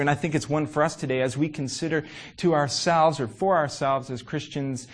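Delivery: ordinary talk, nothing marked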